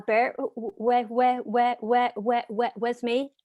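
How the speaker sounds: repeats w- again and again; stuck on the first sound; stuttering. Real, not acted. Speaker who stutters stuck on word.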